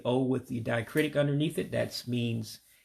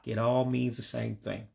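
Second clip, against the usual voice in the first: usual speaking voice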